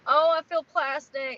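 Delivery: high, loud, slow groan of disgust; loud disgusted lament; loud exclaim. Real, not acted. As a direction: drag it out, loud.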